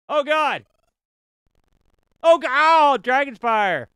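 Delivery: high, loud horrified cry; startled shout. Real, not acted. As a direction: yell as the fire hits. Dragon attacks his character.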